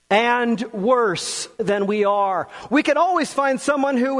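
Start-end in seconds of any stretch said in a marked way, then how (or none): none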